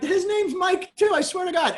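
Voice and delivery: swearing it's true, almost squeaking; desperate pleading. Retold or imitated. Desperate plea, retold.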